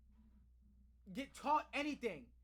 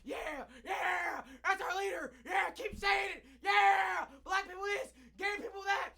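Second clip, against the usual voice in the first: loud, higher hammed-up rally chant; mocking crowd cheer; 4 semitones higher, 4 dB louder, slower than usual. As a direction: jeer like a cheering mob. Mocks cheering MAGA crowd.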